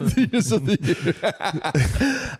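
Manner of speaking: high voice through laughter; giggling through the words; laughing. Real, not acted. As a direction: talk while cracking up. Laughing while retelling.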